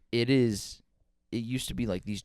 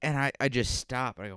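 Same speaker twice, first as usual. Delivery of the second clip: ordinary talk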